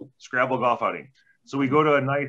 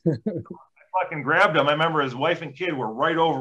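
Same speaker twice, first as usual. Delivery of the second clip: ordinary talk, nothing marked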